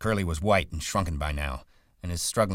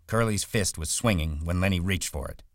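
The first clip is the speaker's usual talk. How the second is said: ordinary delivery, nothing marked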